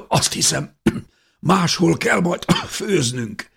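high, slow words broken by coughs; coughing, croaking. A narrator's reading. Aunt in smoky darkness grumbles to the others, coughing. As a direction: choke the words out between coughs. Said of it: "krákogta Elizabeth nénje".

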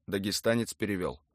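ordinary delivery

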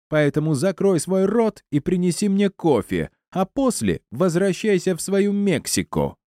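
very high-pitched, cutting orders; high-voiced spiteful woman; scornful tirade. A narrator's reading. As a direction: insult her in a high, imperious voice. Acted quarrel, Елена insults Стефани.

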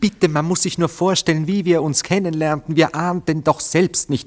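rising indignation, almost squeaking; indignant. Acted.

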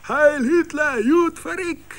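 put-on high, loud drunken bellow; drunken parade chant, shouted high; hoarse drunken shouting. A narrator's reading. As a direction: yell it hoarse like a drunk reveller. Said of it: "schrien in einem fort heiser".

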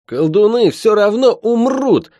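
high, loud bitter outburst; bitter fury. Acted. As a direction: spit it out with rage. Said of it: "с ожесточением произнёс ходящий".

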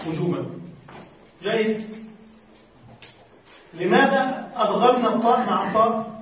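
ordinary talk

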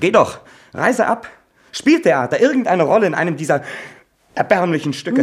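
high, loud mocking scorn with a laugh; jeering shout. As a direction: spit out the taunt, laughing bitterly. Son mocks mother as actress.

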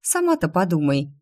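plain reading voice

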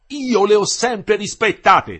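loud, high, slow indignant retort; snapping back. A narrator's reading. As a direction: fire it back, offended. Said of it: "scattò l'ingegnere".

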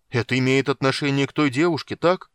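loud, high, insistent shout; shouted question. A narrator's reading. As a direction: yell the accusation down to him. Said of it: "прокричал силач". (громко прокричать вопрос)

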